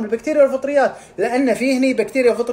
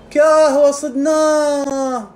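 slow soldier voice; 4 semitones higher, much slower than usual. Acted. Immune cells voiced catching intruder.

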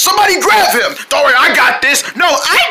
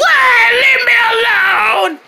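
whiny creature wail; 6 semitones higher, slower than usual. Acted. Runaway creature cries out.

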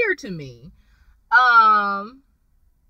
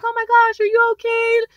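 mock fake concern; 10 semitones higher than usual. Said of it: "pretend and be like".

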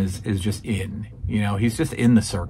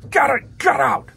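imitating Vince yelling. Vince chasing the Moondog out, high mock yell. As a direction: shoo them out, yelling. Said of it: "Vince like".

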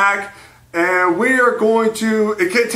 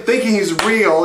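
normal talking voice

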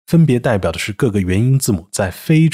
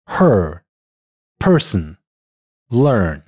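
American r-colored vowel in her, person, learn; American English word demo: her, person, learn; American pronunciation; 3 semitones lower, much slower than usual. Shown as a demonstration. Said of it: "美音".

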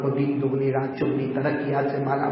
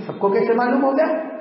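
high, rising astonished question; acted surprise, lifting at the end; puzzled question; 8 semitones higher, 5 dB louder than usual. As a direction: ask it as if baffled. God asks in surprise.

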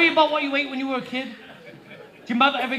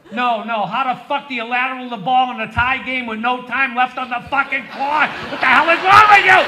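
furious yell; 8 dB louder than usual. Pretend. Comic rages at a team's last-second lateral, yelling. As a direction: blow up at the TV.

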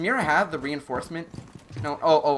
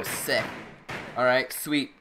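ordinary delivery

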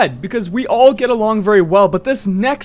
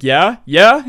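eager questioning, second one louder; excited; 5 dB louder than usual. Acted. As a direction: imitate an excited friend, rising on each question. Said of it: "He's so excited".

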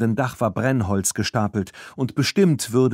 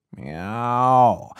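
cat meow; 2 semitones higher, 4 dB louder than usual. Acted. Cat meows.

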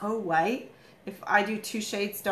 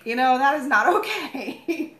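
laughing; 5 semitones higher, 6 dB louder, slower than usual. Real, not acted. Laughing at hose fight story.

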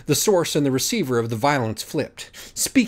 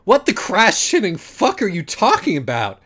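higher, louder exasperated cry; angry outburst; 8 semitones higher, 5 dB louder than usual. Acted. Pony Acorn yelling at his judges, higher and louder. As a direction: blurt it out in disbelief. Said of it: "Acorn neighed".

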